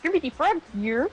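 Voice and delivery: light Kermit impression. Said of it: "I have a Kermit the Frog voice".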